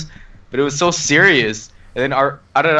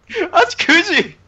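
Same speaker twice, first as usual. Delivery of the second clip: climbing higher, louder wailed why; mock anguished wail; 5 dB louder, slower than usual. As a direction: wail in mock grief, slowing at the name. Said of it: "and I'm just like".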